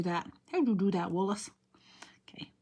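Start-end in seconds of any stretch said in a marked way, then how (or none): 2.3-2.5 concentrated under-breath whisper; murmuring "straight" as she aligns the stamp; whispered focus. Real, not acted. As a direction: whisper "straight" to herself while lining up. Concentrating while placing stamp.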